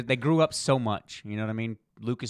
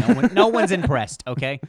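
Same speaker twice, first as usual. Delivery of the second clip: laughing rant; 6 semitones higher, 9 dB louder than usual. Real, not acted. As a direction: scold him while laughing. Mock rant at actor.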